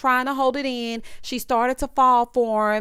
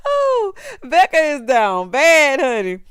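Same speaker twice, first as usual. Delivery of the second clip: slow, louder, higher drawl; loud slow mock pity; drawn-out sass; 5 semitones higher, 7 dB louder, slower than usual. Real, not acted. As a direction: stretch it out teasing. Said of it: "Baby, Becca is down bad".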